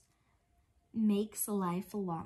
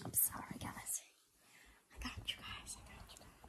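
soft whispered comfort; bedtime whisper; 13 dB quieter than usual. Pretend. Tucking the toys into bed, whispered. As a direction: whisper so the little ones can sleep.

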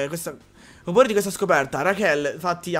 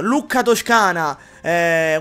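ordinary delivery

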